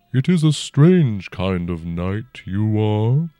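slow, rumbling ancient dragon. A narrator's reading. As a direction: speak as a huge old dragon, unhurried. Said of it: "the dragon breathed".

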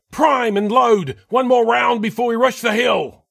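high, loud drill order; yelled command. A narrator's reading. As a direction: shout it to the whole line. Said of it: "Lassane yelled".

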